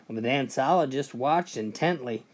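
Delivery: normal talking voice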